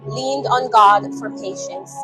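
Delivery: plain reading voice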